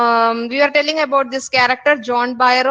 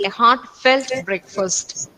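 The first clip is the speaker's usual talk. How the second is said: whispered aside; much slower than usual. Shown as a demonstration. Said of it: "Hearty breakfast means".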